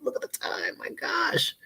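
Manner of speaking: hushed, voiceless exclamation; whispered aside. Real, not acted. Host confessing to viewers about late eating, whispered aside. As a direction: whisper it in dismay at the hour.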